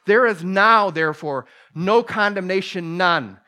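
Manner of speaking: proclaimed loud, each word hammered; preaching shout. Acted. Preacher to congregation, proclaiming the verse at full force.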